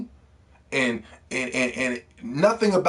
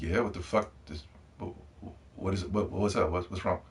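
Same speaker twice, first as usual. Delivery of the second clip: confused; 8 semitones lower, 11 dB quieter than usual. Retold or imitated. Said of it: "I'm like".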